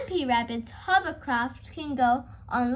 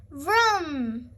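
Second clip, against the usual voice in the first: engine vroom. A narrator's reading. Hovercraft engine noise.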